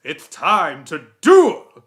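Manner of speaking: loud, slow commanding declaration; ancient pharaonic spirit. Retold or imitated. Ancient spirit calling a duel, loud imperious voice. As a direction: proclaim it grandly, drawing out every word. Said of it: "five thousand-year-old old pharaonic spirit".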